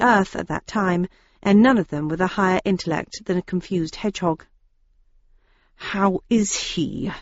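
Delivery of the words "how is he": through half-gritted teeth. Acted. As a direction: squeeze it out through clenched teeth. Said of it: "asked Daisy through half-gritted teeth".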